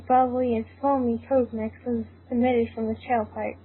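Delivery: normal talking voice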